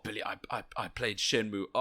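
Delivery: ordinary talk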